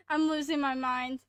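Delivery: ordinary talk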